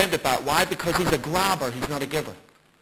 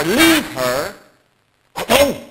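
louder, higher on alone, eye to eye; stern direct rebuke; 4 semitones higher, 6 dB louder, much slower than usual. Retold or imitated. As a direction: hold his gaze and lay down the law. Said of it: "looks this boy in the eye and says".

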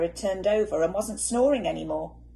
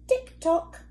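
ticking clock; 10 semitones higher, 4 dB louder than usual. A narrator's reading. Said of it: "went the living room clock".

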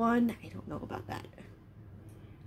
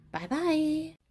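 cute high voice; 8 semitones higher, 4 dB quieter than usual. Acted. Cute sign-off in costume.

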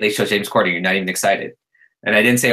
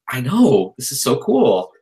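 excited; 4 semitones higher, slower than usual. Retold or imitated. His own retold reaction.

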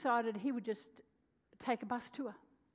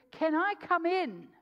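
eager plea; 8 semitones higher, 7 dB louder, slower than usual. Retold or imitated. Young man pleading at the door.

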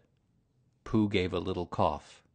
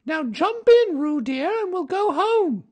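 very high, louder motherly kangaroo voice; 12 dB louder than usual. A narrator's reading. Kanga coaxes Baby Roo to jump once more, very high. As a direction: coax a little one in a high sing-song.

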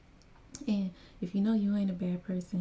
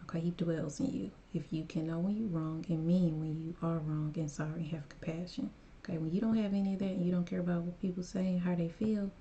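ordinary delivery

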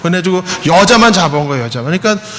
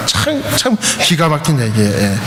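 normal talking voice

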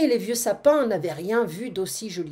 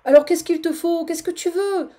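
exasperated plea; 8 semitones higher, 6 dB louder than usual. Acted. Seguin pleads with his goat.

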